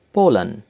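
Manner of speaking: "Poland" in German, as POH-len; German-style "Poland", ending in 'len'; German pronunciation. Shown as a demonstration. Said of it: "names of countries with German accent".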